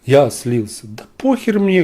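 normal talking voice

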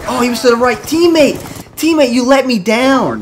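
high, loud complaining shout; high loud slowed accusing shout; exasperated yelling. Real, not acted. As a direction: blame the teammate at full volume. Yelling at teammate.

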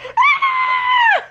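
high, loud mock scream; hammed-up scared squeal; scared shriek. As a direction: imitate a frightened little girl shrieking. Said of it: "She's like".

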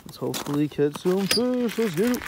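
loud, rising pep-up to kick things off; pumped-up send-off; hyped up. Real, not acted. Hyped intro to fishing trip.

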